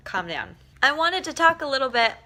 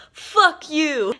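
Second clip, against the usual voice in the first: higher, louder imitated New York insult; mock rude snap; 8 semitones higher, 6 dB louder than usual. Guy on the sidewalk answers his friend, loud mock snap. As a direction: toss it off like a gruff local.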